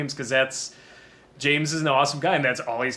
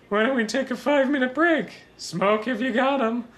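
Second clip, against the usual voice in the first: Rick Moranis impression; 11 semitones higher than usual. Doing Rick Moranis from Spaceballs, a high nerdy voice. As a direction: squeak it like a puffed-up little commander. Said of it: "some Rick Moranis from Spaceballs".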